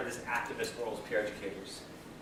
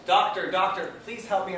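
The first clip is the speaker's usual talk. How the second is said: yelling for help; 5 semitones higher, 5 dB louder than usual. Acted. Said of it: "The guy yells".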